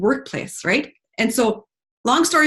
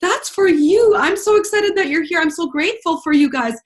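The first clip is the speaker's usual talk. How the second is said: higher, louder grateful outpouring; gushing gratitude; 7 semitones higher, 4 dB louder than usual. Retold or imitated. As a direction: pour out thanks, pitched high. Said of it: "and she said".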